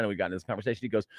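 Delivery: normal talking voice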